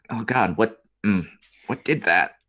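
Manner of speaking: queasy, disgusted radio reaction; gagging over comms. Acted. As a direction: choke it out between gags. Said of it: "Just hear gagging over the comms".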